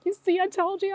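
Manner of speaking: high, gleeful told-you-so; bubbly, giddy I-told-you-so; excited. Retold or imitated. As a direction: crow it, thrilled to be right. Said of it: "I got so excited".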